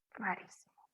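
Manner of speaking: whispered aside, no voice; a hushed rarísimo. Shown as a demonstration. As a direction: breathe it like a secret. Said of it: "whispered aside".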